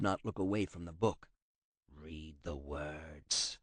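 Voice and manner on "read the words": low, slow, soft threat; menacing command. A narrator's reading. Kratos forcing the translator to read, low slow menace. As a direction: growl the order quietly, leaving no choice.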